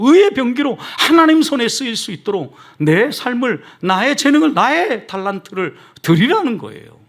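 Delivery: high, ringing sermon exhortation; impassioned preaching. Real, not acted. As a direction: urge the congregation with full conviction. Preacher at full force.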